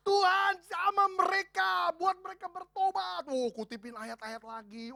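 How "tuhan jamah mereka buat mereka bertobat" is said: high, slow, drawn-out loud plea; hammed-up pleading shout; loud showy prayer. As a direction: pray loud so those outside hear. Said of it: "udah gua teriak aja biar yang di luar dengar".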